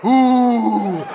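hammed-up wonder ending in a stretched wow; awestruck crowd reaction. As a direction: act out mounting amazement. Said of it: "we'll go".

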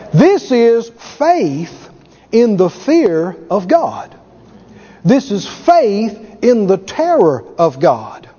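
each word laid down slowly and heavily; slow, weighty. Real, not acted. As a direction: spell it out slowly like a solemn truth. Preacher stressing key phrase.

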